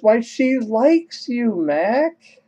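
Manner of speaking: high, slow, delighted girl imitation; lit-up delight. Maria lighting up as the dog likes him, imitated delight. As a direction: gush it high and drawn out. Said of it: "Maria lights up and says".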